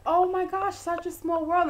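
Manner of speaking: high, loud delighted exclamation; excited reaction. Retold or imitated. Dean amazed at a chance meeting, excited. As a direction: gush like a thrilled acquaintance.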